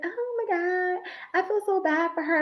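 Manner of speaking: mock pity, pitched high and soft with a fake sob; imitating the gushing sympathizers; mocking sympathy. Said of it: "But everybody was like".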